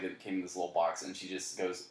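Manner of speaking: ordinary talk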